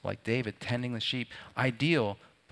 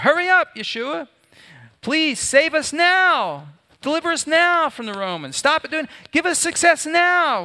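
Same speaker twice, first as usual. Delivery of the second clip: much higher and louder, pleading shout; desperate cry; 12 dB louder than usual. Pretend. The flesh cries to Yeshua for deliverance, desperate shouting. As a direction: cry out begging for rescue now. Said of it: "That's what our flesh wants to say".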